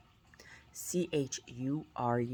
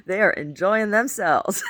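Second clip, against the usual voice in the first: laughing loud; 7 semitones higher, 9 dB louder, slower than usual. Real, not acted. Laughing about kabuki music.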